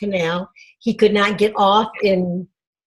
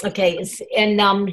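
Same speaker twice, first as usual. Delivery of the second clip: ordinary talk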